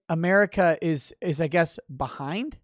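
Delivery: ordinary talk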